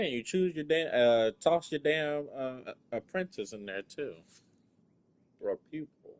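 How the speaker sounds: usual speaking voice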